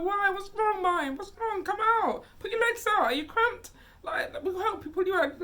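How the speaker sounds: high, loud worried questions; anxious pleading. Retold or imitated. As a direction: coax him out urgently. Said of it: "he's like".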